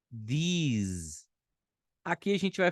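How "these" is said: "these" with a voiced th and a long ee; careful teacher demo of "these"; English pronunciation. Shown as a demonstration. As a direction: model "these" clearly for students. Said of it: "aqui a gente fala".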